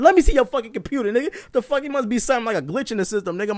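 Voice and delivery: fast, high angry demand; mad. Retold or imitated. Customer grabbing the cashier's monitor, mad. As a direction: rattle off a hot-headed retold protest. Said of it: "I was mad as shit".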